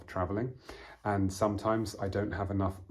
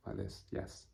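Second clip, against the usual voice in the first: whispered yes; 11 dB quieter than usual. Shown as a demonstration. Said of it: "you normally nod your head".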